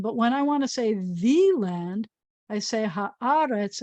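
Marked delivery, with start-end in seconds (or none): 2.8-3.8 "haaretz" slow, stress on the first a. Shown as a demonstration. Teacher modelling Hebrew stress for children, slow. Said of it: "the accent is also gonna be on the first one".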